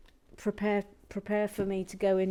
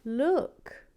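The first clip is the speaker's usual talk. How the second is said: ordinary talk, nothing marked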